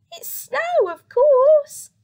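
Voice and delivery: high, loud squeaky mouse voice. Acted. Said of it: "squeaked the mouse".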